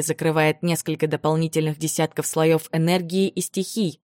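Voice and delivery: usual speaking voice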